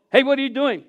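the way not to greet; imitating wife's challenge. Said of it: "don't go".

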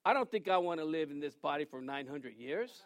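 ordinary talk, nothing marked

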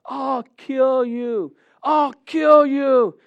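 slow, high drawn-out yell; yelled threat. Retold or imitated. Big guy threatening someone after the game, yelling. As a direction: holler it round the corner, stretching KILL.

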